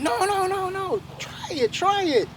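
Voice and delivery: high, slow begging; pleading little muskrat. Acted. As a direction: urge the bear to try. Muskrat begs grizzly bear.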